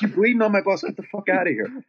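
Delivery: high-pitched indignant scolding; mock outraged order; retold angry driver. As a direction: act out kicking a passenger out. Bus driver ejects bleeding passenger.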